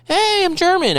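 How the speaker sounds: high-pitched, badly faked German; terrible German. Host contrasts plain talking with German shouting, terrible German impression. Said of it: "That's a terrible German impression".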